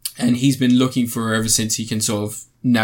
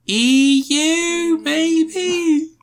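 high mock-doubtful squeak; squeaky unsure; much slower than usual. Unsure of the DC film universe.

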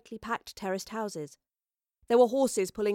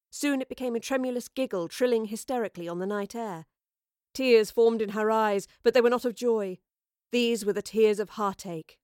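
ordinary delivery

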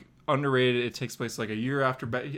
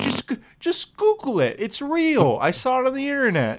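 higher, louder, flustered protest; defensive insistence; 11 semitones higher, 7 dB louder than usual. Real, not acted. Host defending an unsourced news story, flustered insistence. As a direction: insist it's real, half laughing.

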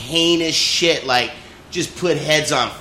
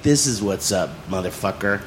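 mock tough guy; 8 semitones lower, 3 dB quieter than usual. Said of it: "He's like".

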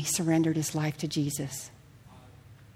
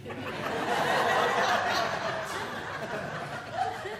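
rising laugh; 3 semitones higher, 10 dB quieter than usual. Real, not acted. Laughing at herself about Hawaii.

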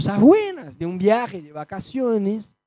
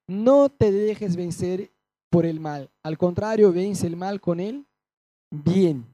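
slow, solemn reading of the verse; scripture reading; 2 semitones lower than usual. Acted. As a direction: read it weighty and slow, like from the pulpit. Reads scripture aloud. (lectura bíblica solemne y lenta)

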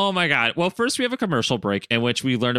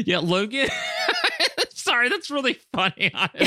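high, squealing laugh through the words; cracking up and apologising; real laughter, voice pitched up; helpless laughter; 13 semitones higher than usual. Real, not acted. Cracking up at the joke.

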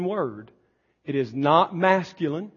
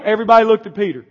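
ordinary delivery

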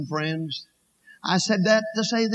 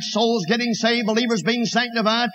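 ordinary talk, nothing marked

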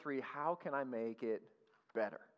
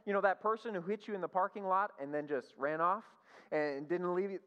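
usual speaking voice

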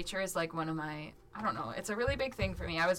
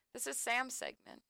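ordinary delivery

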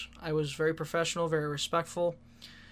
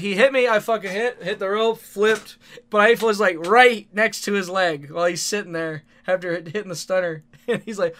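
normal talking voice

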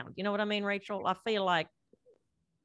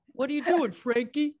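loud, higher New York dad calling out; New York dad accent; 5 semitones higher, 3 dB louder than usual. Pretend. A dad calls his daughter Frankie in a thick New York accent. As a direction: call the kid like a Brooklyn dad. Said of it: "That was a really good accent".

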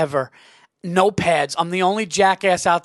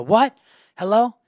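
lightly acted puzzled phone answer, rising; fake phone call; 3 semitones higher, 3 dB louder than usual. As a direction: pretend the line has someone on it. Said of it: "Pretend to be on the phone".

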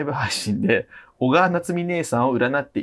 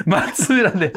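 normal talking voice

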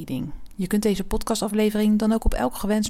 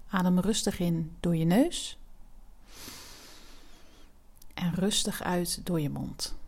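soft spa whisper; 5 dB quieter than usual. Acted. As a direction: whisper them through each breath. Guided breathing exercise.

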